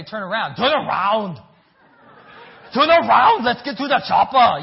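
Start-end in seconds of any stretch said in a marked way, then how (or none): 0.6-1.4 loud Austrian-accented action hero; put-on Austrian accent, forceful; Arnold Schwarzenegger. Shown as a demonstration. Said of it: "like Arnold Schwarzenegger would say". 2.7-4.6 put-on shouted Austrian-accented action hero; very loud mock Austrian accent, higher pitched; Arnold Schwarzenegger. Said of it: "like Arnold Schwarzenegger".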